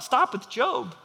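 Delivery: normal talking voice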